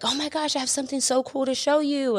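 high, eager speech therapist voice; imitating the therapist's high excited gush. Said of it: "and she's like".

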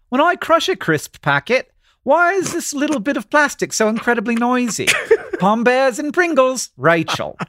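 high, put-on English accent; mock British reader voice. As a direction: read it out in a playful English accent. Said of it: "finish this one in your English accent".

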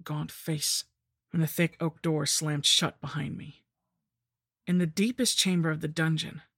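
ordinary delivery

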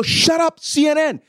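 high, urgent yell; yelling an order down the phone; retold shouting. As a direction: cut him off with a high shout. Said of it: "all I could do was shout".

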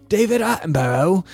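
put-on a quick, clumsy go at a British accent; half-hearted British nature-documentary narrator accent; deliberately bad British accent on the name; bad Attenborough accent. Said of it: "Yeah, that's a bad accent".

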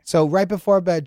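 ordinary talk, nothing marked

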